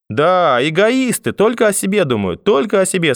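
high-pitched righteous lament; indignant agreement. A narrator's reading. Grandfather agreeing about selfish neighbours, indignant lament. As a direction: draw out the disapproval, pitch way up.

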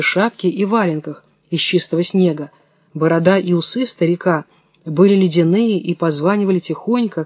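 ordinary delivery

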